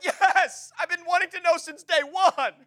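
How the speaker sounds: high, eager acted outburst; retold excitement. Expectant dad answering in ultrasound room, retold excitement. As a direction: blurt it out as if bursting to know.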